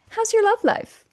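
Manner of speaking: chirpy, high mock question from a relative; high nosy relative voice. Family member prying about your love life, high mock voice. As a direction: pitch it way up like a prying aunt.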